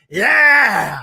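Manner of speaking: loud celebratory yell after singing; loud yeah to cap the song; cheering whoop. Real, not acted. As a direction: shout it out to close the song.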